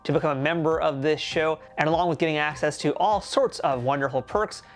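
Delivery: upbeat, projected host sign-off read; podcast outro read. Acted. Host signing off to listeners at the end of the episode, podcast outro read. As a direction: deliver it like a polished show outro.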